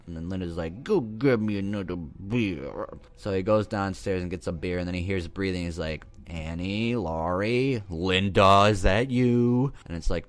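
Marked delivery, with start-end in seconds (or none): 0.8-2.9 drawn-out, distorted mimicry of a demanding girlfriend; exaggerated mock whine, words stretched; mocking Linda. Girlfriend mimics boyfriend's request for a beer, mockingly. 6.3-9.7 acted searching call, building louder. Boyfriend calls to friends after hearing breathing, calling out.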